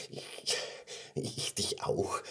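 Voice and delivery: very soft, slow, hesitant whisper; bashful murmur. Acted. Blushing gummy bear returns bear's love, soft shy whisper. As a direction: whisper it shyly, faltering. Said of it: "wurde es ein klitzeklein wenig rot".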